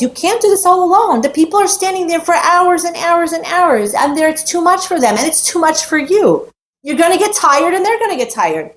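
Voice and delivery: high, earnest voice of an advising elder; Yitro advising. Retold or imitated. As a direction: urge him kindly to share the load. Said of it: "Yitro says".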